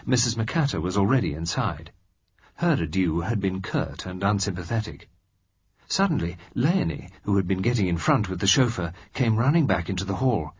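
ordinary delivery, nothing marked

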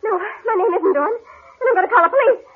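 very high, tearful panic; terrified. Acted. Woman denies her name to attacker, terrified. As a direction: refuse in a frightened, high voice.

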